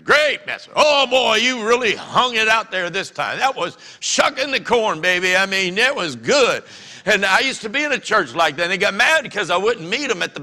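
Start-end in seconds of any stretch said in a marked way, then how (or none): 0.0-0.7 louder, higher gushing praise; mock hearty shout. Churchgoer gushing at the preacher on the way out, loud. 0.7-6.6 higher, hammed-up enthusiastic praise; gushing churchgoer. Churchgoer flattering the preacher at the door, gushing.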